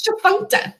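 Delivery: chifuncta with a hard k before the last syllable; hard middle sound. Shown as a demonstration. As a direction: reveal chifuncta with a clear "nk". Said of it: "that harder sound between the".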